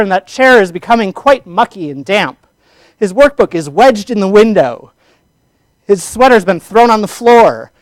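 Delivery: loud, high, energetic poem recital with swooping inflection; dramatic reading. Shown as a demonstration. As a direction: read it dramatically with a touch of anger, rising and falling pitch. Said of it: "give you all a dramatic reading".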